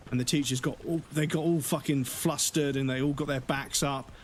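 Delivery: ordinary delivery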